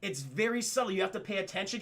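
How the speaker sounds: ordinary delivery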